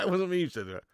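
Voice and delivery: repeated in a put-on voice, not his own; put-on denial voice. Shown as a demonstration. Streamer jokingly denying a chat prediction, put-on voice. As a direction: say it again as a mock character. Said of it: "repeats the line in another voice".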